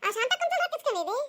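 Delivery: high-pitched mocking village gossip; teasing neighbour. Neighbour teasing about borrowed money.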